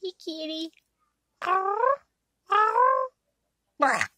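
high meow-like call; cat sound. Pretend. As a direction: try to mew like a cat. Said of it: "can't do cat sounds that well".

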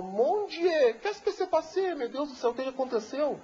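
high alarmed voice of the French lady; French madame. Retold or imitated. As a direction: cry it out as the shocked Frenchwoman, high. Foreign madame finds the flooded house. (madame francesa assustada, voz aguda)

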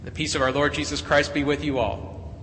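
normal talking voice

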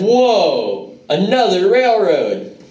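high, amazed gasp at the railroad. Pretend.

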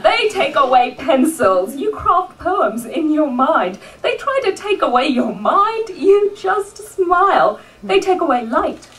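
rousing slam-poetry delivery, swelling and falling; rhythmic live poetry recital; spoken-word poem. Acted. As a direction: perform it as a defiant tribute poem. Performs a spoken-word tribute poem.

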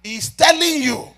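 loud, ringing preacher's cry over the music; thundering sermon delivery; full-force preaching. Acted. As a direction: preach it out to the back row.

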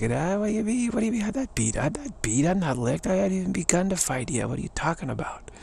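high-pitched, indignant protest; fighting attitude. Acted. Fighter denying he's beaten, indignantly defiant. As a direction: play a scrappy fighter refusing defeat. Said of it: "that fighting attitude is like".

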